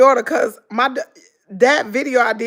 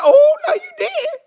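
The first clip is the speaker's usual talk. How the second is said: shrieked high, loud oh no you didn't; mock outrage; 5 dB louder than usual. Teasing her daughter, shrieking high in mock outrage. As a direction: play it as scandalized disbelief.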